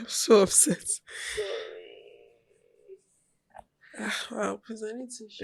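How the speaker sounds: tearful, voice catching, trailing to a near whisper; fighting back real tears; crying. Real, not acted. Upset friend admits crying over sad story, tearful. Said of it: "I don't even know why I'm crying".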